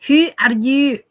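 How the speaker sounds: loud Glaswegian "Who are you?" with a rolled, broad vowel; Scots accent. Shown as a demonstration. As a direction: bark it out like a Glasgow local. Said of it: "That's your typical Scots accent".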